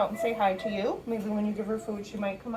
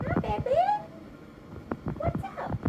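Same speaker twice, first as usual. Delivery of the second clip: pet talk. Real, not acted. Cooing to stressed cat.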